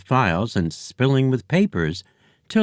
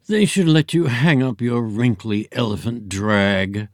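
drawl. Acted. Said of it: "drawled Hati".

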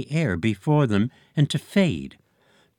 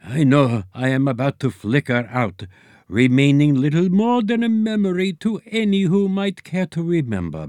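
Einstein ghost voice; 7 semitones higher than usual. A narrator's reading. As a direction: lecture like a fading genius. Einstein farewell speech.

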